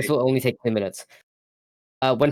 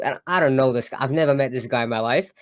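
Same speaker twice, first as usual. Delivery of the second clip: ordinary talk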